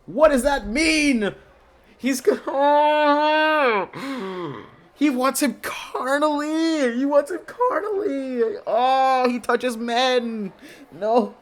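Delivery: high, loud scandalised shriek; shrieking disbelief. Real, not acted. Gamer shrieking at a character's flirting, scandalised. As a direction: squeal at the flirty line.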